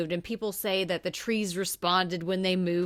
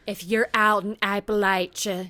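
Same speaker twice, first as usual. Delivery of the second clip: loud, hammed-up woman giving a warning; exaggerated imitation; 5 dB louder than usual. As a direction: overdo her warning, loudly and emphatically. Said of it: "she's not that bad".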